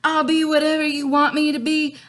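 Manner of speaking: high, pleading Juliet; eager young lover begging. Acted. Teacher voicing Juliet, lines repeated.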